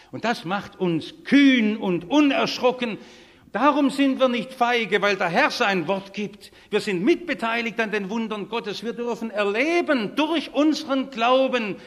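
loud, high, emphatic sermon voice; rousing preaching. Real, not acted. Preacher telling the congregation God's word makes them fearless, rousingly. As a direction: proclaim it at full force to embolden them.

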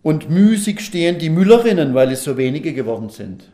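high and loud, easing off at the end; scripture reading. Acted. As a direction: read the verse out to the whole congregation. Reads out the Bible verse.

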